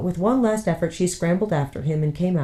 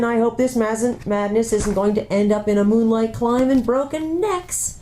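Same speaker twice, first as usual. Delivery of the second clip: much higher, exasperated dwarf oath; dwarf's oath; 7 semitones higher than usual. Acted. Dwarf grumbling to himself on the cliff edge, exasperated. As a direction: mutter a dwarf's curse. Trumpkin worrying.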